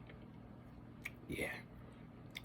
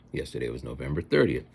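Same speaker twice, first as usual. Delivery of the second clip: normal talking voice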